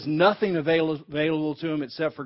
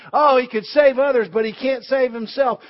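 loud, higher jeering taunt; mocking taunt; 8 semitones higher, 8 dB louder than usual. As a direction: sneer it out at the cross. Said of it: "what voice is that?".

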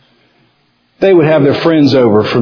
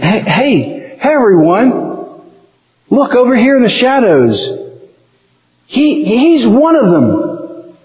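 put-on little girl calling out; 8 semitones higher than usual. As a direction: call out like an eager little girl. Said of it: "the little girl says".

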